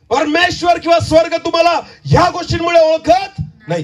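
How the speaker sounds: high, ringing preacher's cry; full-force preaching. Acted. Preacher rebuking the congregation, high ringing shout. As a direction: drive each line home to the crowd.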